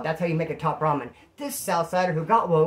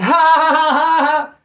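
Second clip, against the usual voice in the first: high, loud mock belly laugh; 11 semitones higher, 12 dB louder than usual. Shown as a demonstration. Showing how they laughed after lights out, loud hammed-up laughing. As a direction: burst into exaggerated loud ha-ha-has. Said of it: "Laughing loud".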